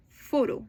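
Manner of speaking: PHOto, strong opening syllable; "Photo" as a demo, weight on PHO; first-syllable stress. Shown as a demonstration. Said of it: "the first syllable is stressed".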